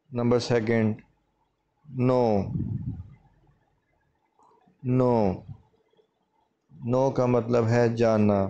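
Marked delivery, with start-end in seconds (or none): 1.9-5.4 नो said as plain "no", no k sound; नो with the k left silent. Shown as a demonstration. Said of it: "नो में के silent है". (नो में के silent, सिर्फ़ "नो")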